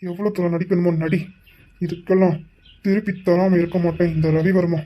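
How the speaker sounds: low, soft menacing vow; muttered threat. Acted. Ravi quietly vows revenge on Nethra. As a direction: threaten under your breath.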